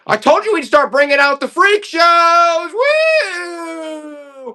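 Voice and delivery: big high shout over the freak shows; excited shout. Real, not acted. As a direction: yell it out like a carnival barker. Announcing freak show dolls.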